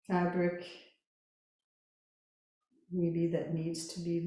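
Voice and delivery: slow, calm Yoga Nidra guidance with long pauses; guided meditation. Real, not acted. Yoga teacher settling listeners lying down, calm guided meditation. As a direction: guide them gently into rest, unhurried.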